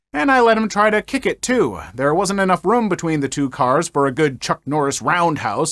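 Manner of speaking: plain reading voice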